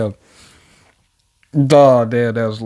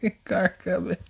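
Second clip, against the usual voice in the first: laughing warning. Retold or imitated. Reader imitates Saitama warning of a car, breaking into laughter. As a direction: start the warning straight, then crack up. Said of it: "trying to tell him in earnest".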